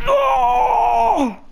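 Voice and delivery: long, high howl of defeat; anguished shout. Real, not acted. Player killed by Slender, anguished shout. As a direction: howl the word out in despair.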